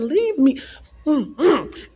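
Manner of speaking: fighting back a sob; choked up. Real, not acted. Retelling refusing the voice in church, choked up.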